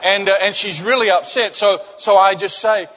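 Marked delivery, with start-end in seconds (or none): none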